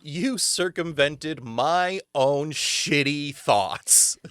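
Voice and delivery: slow, deliberate sarcastic praise; drawn-out mock customer praise; mock congratulations. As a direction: spell out the backhanded compliment word by word. Mocking the customer's backhanded praise.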